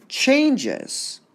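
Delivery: "Changes" with CHANGE pushed high, ending falling; stress on first syllable. Shown as a demonstration. Teacher confirms the answer, strong stress on CHANGE in "Changes". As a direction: hit CHANGE hard in "Changes", drop the -es. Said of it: "it's the change part that's stressed".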